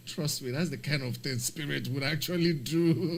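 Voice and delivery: laughing. Real, not acted. Joking about Spirit airline.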